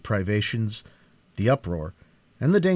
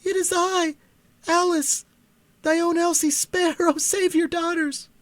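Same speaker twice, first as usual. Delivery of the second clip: put-on high, urgent girl's plea; Alice pleading with her father, high urgent voice; young daughter pleading; 3 dB louder than usual. A narrator's reading. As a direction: beg your father to spare you.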